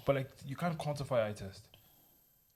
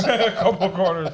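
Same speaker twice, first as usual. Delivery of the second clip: laughing; 5 semitones higher, 11 dB louder, slower than usual. Real, not acted. Laughing at friend's admission.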